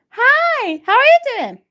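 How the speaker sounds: usual speaking voice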